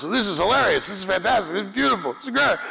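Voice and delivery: mounting high-pitched gushing; gushing fan comments. Retold or imitated. Voicing gushing fans online. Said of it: "it was like seven comments".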